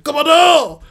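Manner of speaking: high, loud cry; soldier's shout. Retold or imitated. Another soldier calling his commander, shouting. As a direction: yell it across the field. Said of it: "Un autre fait".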